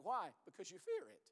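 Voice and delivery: normal talking voice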